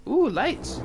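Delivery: high startled ooh; surprised. Real, not acted. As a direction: caught off guard by the lights. Startled by lights in game.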